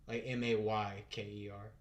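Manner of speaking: ordinary talk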